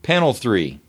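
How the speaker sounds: usual speaking voice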